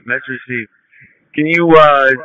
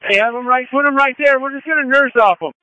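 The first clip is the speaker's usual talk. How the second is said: calling out on scene; 10 semitones higher than usual. Real, not acted. Chief calling out at fire scene.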